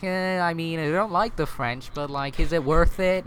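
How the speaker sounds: lightly voiced reluctant ally, unconvinced; dithering ally weighing whether it's worth it; reluctant Ottomans. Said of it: "so the Ottomans were like".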